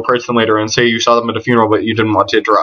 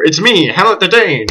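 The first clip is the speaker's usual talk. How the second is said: higher, grand announcement; dramatic self-introduction; 6 semitones higher than usual. Acted. Hamlet reveals himself at the funeral, grandly proclaiming. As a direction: step from the shadows and proclaim yourself. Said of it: "introduce yourself dramatically".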